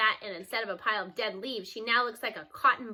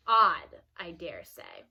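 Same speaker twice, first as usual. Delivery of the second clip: knowing grandma; 7 semitones lower, 5 dB louder, much slower than usual. A narrator's reading. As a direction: raise an eyebrow with your voice. Grandma eyebrows raised.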